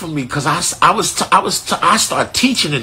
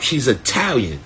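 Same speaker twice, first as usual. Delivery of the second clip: ordinary talk, nothing marked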